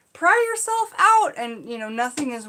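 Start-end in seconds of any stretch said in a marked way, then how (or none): 0.1-1.3 high, loud desperate instruction; urgent shout. Retold or imitated. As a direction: yell it across the mud. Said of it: "and I'm like".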